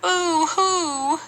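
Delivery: high, put-on mock wail. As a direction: wail it like a lost little girl. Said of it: "she wailed".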